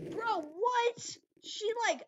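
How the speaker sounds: high-pitched disbelief; incredulous outburst. Real, not acted. Player just knocked out, high incredulous cry. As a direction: burst out high in protest.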